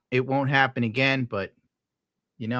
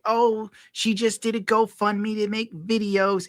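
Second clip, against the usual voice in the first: mocking quote; 9 semitones higher than usual. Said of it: "all I see on Twitter is like".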